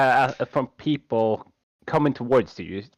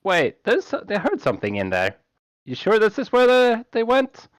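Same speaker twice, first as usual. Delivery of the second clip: guard voices; 8 semitones higher than usual. Acted. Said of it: "you can hear them say".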